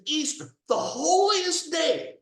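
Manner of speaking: indignant cry, loud and high; outraged shout. Real, not acted. Outraged at Easter declaration.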